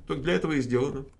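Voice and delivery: normal talking voice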